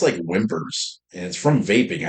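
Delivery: ordinary talk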